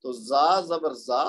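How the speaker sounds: زا sounded out slowly, syllable by syllable; teacher drawing out each letter; slow spelled syllables. Shown as a demonstration. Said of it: "زا ہے".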